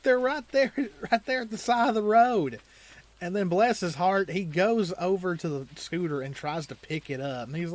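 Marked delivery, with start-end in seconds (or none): 0.0-2.6 exasperated. Retold or imitated. Exasperated reply to crying brother.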